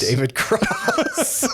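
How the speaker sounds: high, drawn-out laughing echo. Real, not acted. Co-host echoing the perfect casting, laughing. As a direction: stretch the name out into a laugh.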